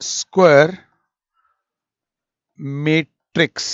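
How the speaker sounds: a playful drawn-out square matrix; teasing slow reveal. Shown as a demonstration. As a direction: spell out square matrix playfully, one word at a time. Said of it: "पंगे ले रहा हूं यार".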